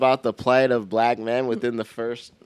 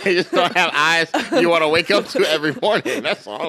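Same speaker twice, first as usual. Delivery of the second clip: talking through laughter; giggling while defending herself; laughing; 6 semitones higher, 4 dB louder than usual. Real, not acted.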